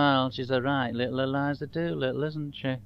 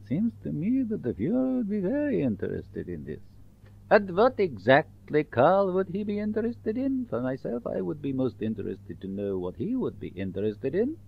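put-on German accent. German officer character voice.

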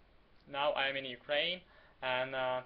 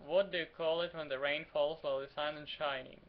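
ordinary delivery

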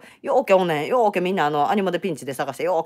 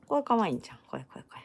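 cooing whisper; 5 semitones lower, 8 dB quieter, faster than usual. Real, not acted. Cooing over a cute design.

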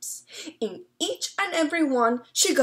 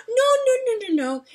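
higher, louder no no no; interrupting; 12 semitones higher, 8 dB louder, much faster than usual. Acted. Señora Amelia interrupting the man, high and urgent. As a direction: cut him off in a rush. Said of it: "interrupted Señora Amelia".

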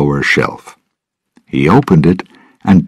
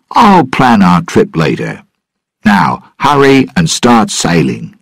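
higher-pitched captain's command; giving orders; 6 semitones higher than usual. A narrator's reading. Jack sends his crew off to sail, giving orders. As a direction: order the crew along with mild firmness. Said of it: "Jack ordered".

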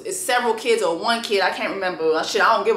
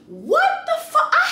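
shocked disbelief. Real, not acted. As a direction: squeal it out in disbelief. Disbelief at food complaint.

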